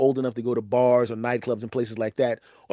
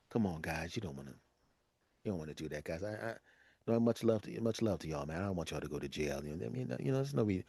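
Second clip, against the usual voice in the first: soft pleading; 6 semitones lower, 15 dB quieter, faster than usual. Retold or imitated. Retold calming down fighting dudes.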